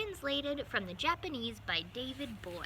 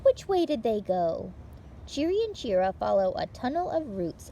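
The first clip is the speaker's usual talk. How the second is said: bright, lively read-aloud for little listeners; picture-book reading; 4 semitones higher, 9 dB louder than usual. Acted. Librarian reading a picture book to children, lively read-aloud. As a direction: tell it like story time for small children.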